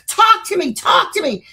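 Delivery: high, loud yell of talk to me; screaming at the box. Retold or imitated. Investigator shouting at the demon box to talk to her. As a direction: shout right into the box, demanding an answer. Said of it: "you hear me screaming at the box".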